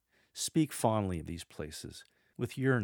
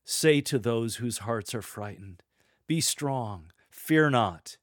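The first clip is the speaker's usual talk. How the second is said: loud, raised proclamation, slowing on the last line; rousing rallying cry; 3 semitones higher, 7 dB louder, slower than usual. Shown as a demonstration. Lector to frightened hearts, rousing rallying cry. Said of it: "this rousing, rallying cry continues".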